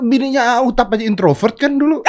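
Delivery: high-pitched, laughing comeback; laughing defence. Real, not acted. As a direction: argue back while starting to laugh. Laughing defence of being introvert.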